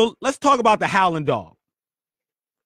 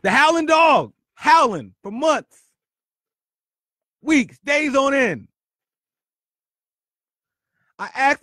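fired-up retelling; 11 semitones higher, 5 dB louder than usual. Real, not acted. Host shouting the story's punchline back to listeners, high and loud. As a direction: shout it out to drive the point home.

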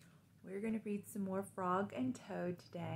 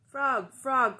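high, loud calling out; 5 semitones higher, 13 dB louder than usual. Acted. Toad calls loudly to Frog at his door. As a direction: shout his friend's name from outside. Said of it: "cried Toad".

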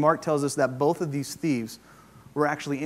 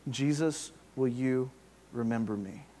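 softer, quiet and lowly request to Jesus; humble plea; 2 semitones lower, 5 dB quieter than usual. Retold or imitated. Thief humbly asking Jesus to remember him, soft and quiet. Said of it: "humbly says".

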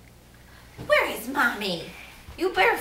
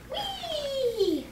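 excited whee; 13 semitones higher than usual. Pretend. Ride sound for toy.

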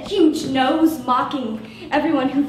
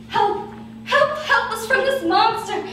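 much higher, hammed-up shrieking plea; mock cry for help; 7 semitones higher than usual. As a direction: wail for help like a B-movie victim. Crying for help.

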